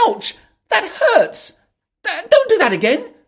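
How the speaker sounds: put-on high, loud, fast girlish complaint; Alice pelted with pebbles; hurt protest. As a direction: yelp and protest, half laughing.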